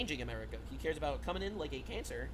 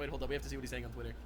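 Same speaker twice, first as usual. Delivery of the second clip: quick quiet aside; 6 semitones lower, 6 dB quieter, much faster than usual. Real, not acted.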